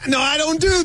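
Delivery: indignant high shout. Real, not acted. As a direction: shout it in disbelief. Protesting the Bubba impression.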